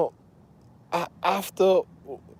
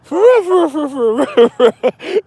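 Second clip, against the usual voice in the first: high, loud, breaking into laughter; laughing insistence; 9 semitones higher, 9 dB louder than usual. Real, not acted. Cracking up as he swears to the listener he's serious. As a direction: keep insisting while cracking up.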